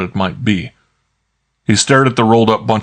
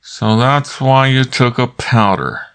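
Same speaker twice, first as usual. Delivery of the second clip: slow words through gritted teeth; 5 semitones higher, slower than usual. A narrator's reading. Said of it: "through his teeth".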